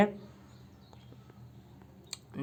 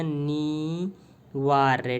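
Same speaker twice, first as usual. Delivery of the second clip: अनिवार्य drawn out very slowly, syllable by syllable; slow dictation; much slower than usual. Shown as a demonstration. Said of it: "केवल आपको इतना रहे".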